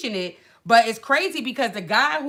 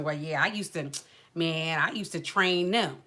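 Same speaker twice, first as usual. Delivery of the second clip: imitating the instructor; 6 semitones lower, 5 dB quieter than usual. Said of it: "And this how she be too".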